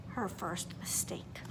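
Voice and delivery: hushed, slow whisper; suspense whisper. A narrator's reading. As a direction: drop to a secret whisper. Suspense whisper in read-aloud.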